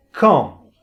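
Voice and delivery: "Quand" said with nasal "kɑ̃", falling; model word to repeat. Shown as a demonstration. Teacher says "Quand" for students to echo. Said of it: "intenta de hacer el mismo sonido".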